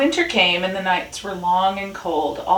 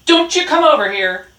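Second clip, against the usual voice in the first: loud, raised warning; barked shout; 9 semitones higher, 9 dB louder than usual. A narrator's reading. Dog warns Rat to stay away, barking loudly. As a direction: bark a warning across the yard. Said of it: "barked Dog".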